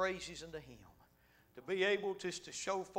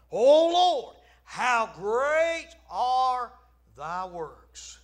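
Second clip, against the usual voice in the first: much higher, louder, stretched slowly; preached cry; 6 semitones higher, 16 dB louder, much slower than usual. Acted. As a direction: proclaim the verse in full fervour. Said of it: "notice that scripture there".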